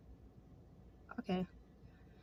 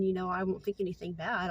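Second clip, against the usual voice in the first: ordinary delivery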